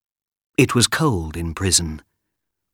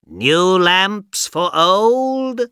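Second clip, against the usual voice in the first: high, slow hawker's call; peddler's street cry; 10 semitones higher, much slower than usual. Acted. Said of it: "he shouted".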